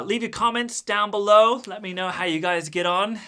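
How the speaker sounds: high, loud call-out to viewers; loud sign-off. Real, not acted. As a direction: belt out the outro to your audience.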